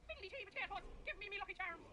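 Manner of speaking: put-on Irish leprechaun accent. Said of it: "You think I sound like a leprechaun?".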